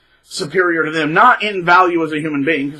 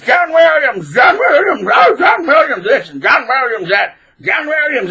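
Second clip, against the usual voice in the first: much higher, louder mock chant of the name; mocking name-dropping; 8 semitones higher, 5 dB louder than usual. Guitarist endlessly invoking [name], mocking chant. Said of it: "he would always evoke the name [name]".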